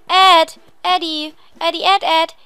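loud, insistent name-calling; bright repeated hollers. Pretend. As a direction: call for a shopkeeper from outside. Calls out for game character.

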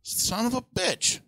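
slow exasperated curse. Retold or imitated. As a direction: mimic a guy fed up with an idiot, slowly. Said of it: "He's like".

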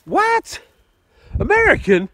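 can't believe it; astonished. Real, not acted. Hearing his mate's coin is American, high and astonished.

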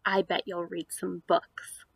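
ordinary talk, nothing marked

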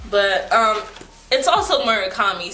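usual speaking voice

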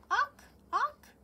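high loud squawk; jay squawk. Acted. Jay sound second time.

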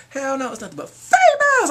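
high, loud delighted cry; excited shout. Real, not acted. Fan shouting about the all-female lineup. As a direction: yell it out thrilled.

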